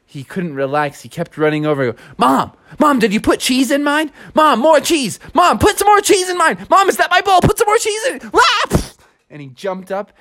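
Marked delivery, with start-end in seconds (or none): 2.2-8.2 put-on high whiny kid voice, climbing higher; demanding kid. Kid demanding more cheese. 8.3-8.8 high startled cry. Acted. Kid's sudden cry as he jumps.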